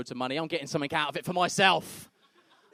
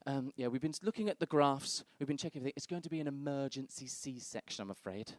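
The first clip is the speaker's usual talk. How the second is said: doctor breaking news; 2 semitones lower, 9 dB quieter than usual. Acted. Doctor announcing emergency C-section, hushed and apologetic. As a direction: deliver bad news quietly, dropping away at the end. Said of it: "the doctor comes in and goes".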